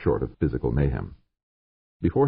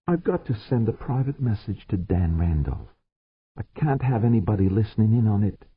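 higher, lightly voiced plea; pleading; 8 semitones higher than usual. George begging the controller for privacy, higher plea. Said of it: "George pleaded".

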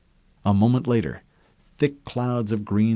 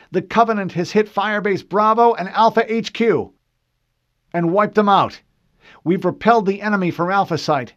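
higher, louder urgent plea; urgent radio call; 12 semitones higher, 8 dB louder than usual. A narrator's reading. Corporal pleads with fleet for evac, urgent and loud. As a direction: push hard for evacuation.

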